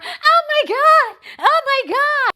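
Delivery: very high, loud panicked shrieking; panicked squeal. Retold or imitated. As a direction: shriek it in helpless panic on the curb. Joan panics watching friend jumped.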